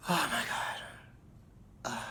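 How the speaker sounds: a slow, airy oh my God; breathy slow sigh. Real, not acted. Sighing to viewers, lost in the memory. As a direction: let it out on a long breath.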